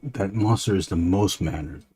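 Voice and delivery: usual speaking voice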